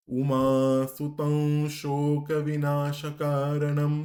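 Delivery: সুতং with the special nasal before শোক, slow and loud; special anusvara. Shown as a demonstration. Teacher showing special anusvara in সুতং before শোক, slow. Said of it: "যেমন".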